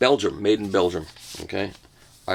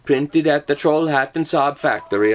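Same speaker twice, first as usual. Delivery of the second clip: higher, lilting put-on Swedish accent; mock Swedish accent; 5 semitones higher, 4 dB louder than usual. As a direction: read it as a hammed-up Swede. Put-on Swedish after guessing Sweden.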